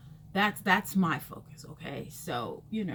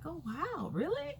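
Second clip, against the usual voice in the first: much higher, ending on a rising "really?"; mock surprise; 10 semitones higher, 4 dB quieter than usual. The stranger is amazed the wig is from Amazon, much higher. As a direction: sound amazed, with "really?" swooping up.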